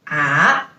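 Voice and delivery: ah low dip then rise; third tone ah. Shown as a demonstration. Teacher repeating the low third tone. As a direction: drop ah into a low V. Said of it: "just like this".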